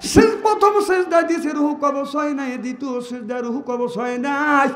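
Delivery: sung, surging sermon chant; preaching at full force. Acted. Preacher telling Fatema's death to the gathering, chanting. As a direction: chant the story to the crowd.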